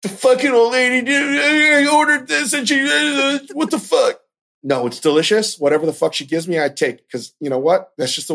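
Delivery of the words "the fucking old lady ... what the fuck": higher, mocking voice of a whiny customer; lightly voiced whiny reviewer; mock angry reviewer. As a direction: parody a petty complainer, lightly. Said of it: "You don't see me writing like".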